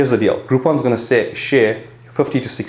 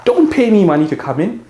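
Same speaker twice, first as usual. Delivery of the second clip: a higher, upbeat sales pitch to new customers; 7 semitones higher than usual. Retold or imitated. Gym owner pitching a Groupon trial to new customers, upbeat and higher. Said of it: "So I will say".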